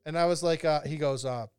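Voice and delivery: ordinary talk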